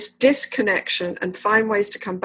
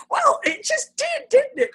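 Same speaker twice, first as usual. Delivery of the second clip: laughing. Real, not acted. Therapist laughing at the breakthrough.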